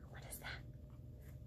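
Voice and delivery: hushed curious whisper. Acted. Teacher whispering to the class about the picture, hushed. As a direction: lean in and wonder softly.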